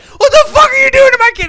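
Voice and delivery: screaming, high-pitched fury; furious shout. Acted. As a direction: scream it at the doctor, voice cracking. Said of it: "And Harry Williams like".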